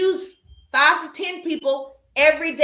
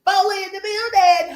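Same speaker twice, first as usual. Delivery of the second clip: hype shout-out; 6 semitones higher, 5 dB louder than usual. Real, not acted. Greeting viewers arriving in her live stream, loud and sing-song. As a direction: call out each name like a hype host. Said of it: "shouting out live viewers".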